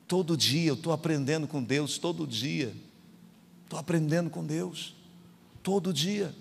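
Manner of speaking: soft, subdued sermon voice; hushed reflective preaching. Real, not acted. Pastor confides he learns from God daily, hushed. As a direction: drop the volume and let it sink in. Reflective low passage after the climax.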